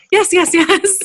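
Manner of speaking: laughing yes. Real, not acted. Eager laughing agreement.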